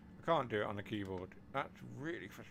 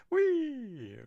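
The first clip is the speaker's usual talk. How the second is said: high loud gleeful squeal; excited whee; 12 dB louder than usual. Real, not acted. Train sliding past the platform.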